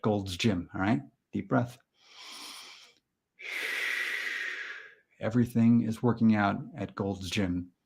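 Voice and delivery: slow, softened affirmation voice; measured meditation-leader delivery; deadpan mock mantra. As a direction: recite it calmly, breathing between takes. Leads a mock mantra exercise.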